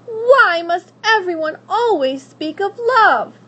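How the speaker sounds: high, loud, stamping complaint; petulant tantrum. Acted. Princess stamping her foot at the witch, petulant. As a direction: whine like a spoiled child.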